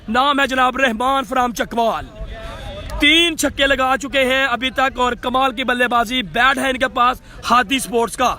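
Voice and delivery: usual speaking voice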